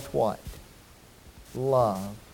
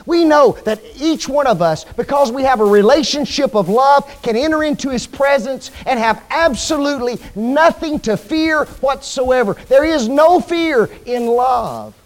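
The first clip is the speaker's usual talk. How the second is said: raised, higher and louder preaching, slowing on the last line; 7 semitones higher, 6 dB louder than usual. Acted. Preacher assuring the folks of God's love, full-voiced. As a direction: lift the congregation with full conviction.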